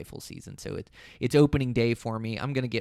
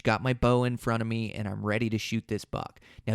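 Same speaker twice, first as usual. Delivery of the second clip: ordinary talk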